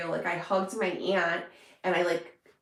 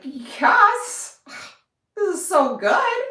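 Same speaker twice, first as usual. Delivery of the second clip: much higher and louder blissful gush; delighted gush; 12 semitones higher, 10 dB louder, much slower than usual. Retold or imitated. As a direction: swoon over it with a sigh. Reacting to true crime shows.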